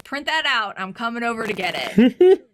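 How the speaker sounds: loud imitation of grandpa giving orders; grandpa's demand. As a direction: boom it out as grandpa on the phone. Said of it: "Or he's like".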